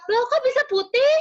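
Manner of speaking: high, loud, quick disbelief; mock astonished grandma. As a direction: squeal it in baffled surprise. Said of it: "Oma Oma Indonesia".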